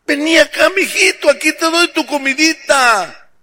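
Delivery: high, shrill-pitched horrified doting mom; fussing mother. Acted. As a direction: wail over the poor boy like a doting mother. Mother fusses over son.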